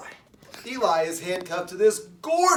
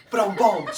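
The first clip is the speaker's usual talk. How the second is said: loud mimicked drum sting; vocal rimshot; 6 dB louder than usual. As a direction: hit it like a drummer capping a joke. Voiced drum rimshot after the punchline.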